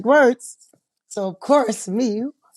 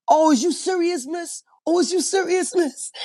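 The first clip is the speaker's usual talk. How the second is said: high-pitched, mock-incredulous client; Tyrone impression; 10 semitones higher than usual. Excited client questioning the woman with the card, hammed-up high impression. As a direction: imitate him in a much higher voice. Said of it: "He was like".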